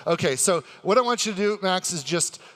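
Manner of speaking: ordinary delivery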